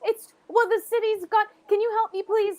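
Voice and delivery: put-on panicked child begging strangers for help; scared boy pleading. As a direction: beg for help as a desperate young boy. GM voices frightened boy.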